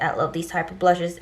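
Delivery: ordinary delivery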